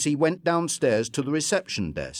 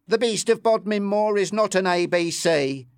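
insistent; 8 semitones higher, 3 dB louder than usual. Acted. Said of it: "insisted Bill".

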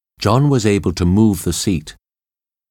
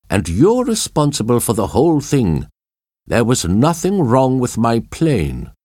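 higher-pitched indignant blame; lightly acted angry accusation; accusing; 5 semitones higher than usual. A narrator's reading. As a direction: pin the whole thing on him, pitch raised. Brunt blames John for the crash.

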